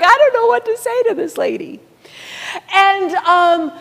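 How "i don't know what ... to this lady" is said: high, fast, baffled muttering to himself; lost for words and a little panicked; flustered aside. Retold or imitated. Volunteer stuck on the phone with an angry lady, baffled. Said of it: "He's like".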